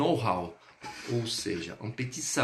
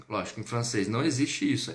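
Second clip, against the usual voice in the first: ordinary talk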